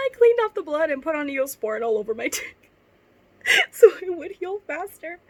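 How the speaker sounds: high, loud laughing read; laughing while reading. Real, not acted. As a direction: push through the words while cracking up. Laughing at her own fanfic.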